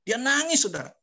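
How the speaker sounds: high-pitched, loud outburst of amazement; astonished exclamation. Retold or imitated. Preacher revealing the man's tears to congregation, astonished exclamation.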